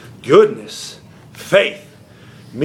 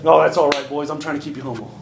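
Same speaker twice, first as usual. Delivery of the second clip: low, softly dismissive reassurance; mock lenient Jesus; 5 semitones lower, 5 dB quieter than usual. Preacher parodies Jesus excusing the disciples, mock lenient. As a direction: let them off easy, voice trailing away. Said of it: "That ain't what he said".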